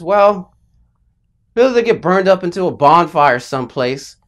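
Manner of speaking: loud, matter-of-fact dad delivering bad news; bluntly honest dad. Dad telling child the tree's fate, bluntly honest. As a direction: lay out the grim truth loudly, unsparing. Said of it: "neurotically committed to being honest".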